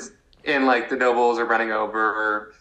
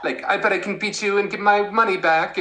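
childish mock Edmund; 9 semitones higher than usual. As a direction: play the naive boy-king teasing his rival, laughing through it. Acting out kid king Edmund.

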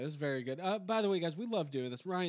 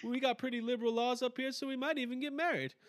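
high, loud hammed-up Canadian; chirpy mock Canuck storytelling; playing the Canadian friend, higher and louder; Canadian caricature; 7 semitones higher, 3 dB louder than usual.

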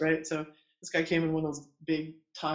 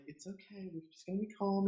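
very soft, slightly higher hush; retold soothing calm; 4 semitones higher, 9 dB quieter than usual. Midwife calming a crowded room as the nurse flips out, soothing. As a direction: settle the room in a hushed voice.

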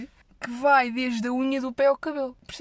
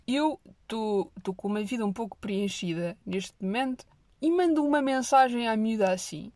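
ordinary talk

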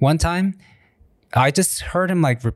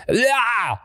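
put-on high little kid yell; mock baby shouting instead of a name; imitating a toddler; 11 semitones higher than usual. Son calling his dad, high toddler cry. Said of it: "my son still calls me".

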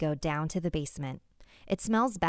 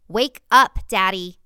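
much louder, impatient wake up yell; angry shout; 3 semitones higher, 13 dB louder than usual. Acted. Girl yelling at tied-up daddy, fed up, loud. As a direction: bark it at him after kicking. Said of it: "I kick him a little".

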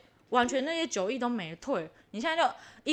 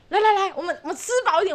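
laughing mock urging; 6 semitones higher, 4 dB louder, faster than usual. Urging husband at buffet.

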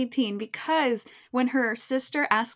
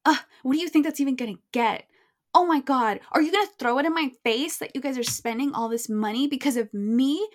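louder, higher mock exasperation; exasperated teen outburst; 4 semitones higher, 4 dB louder than usual. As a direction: act out a daughter fed up with mom. Acted teen quarrel with mom.

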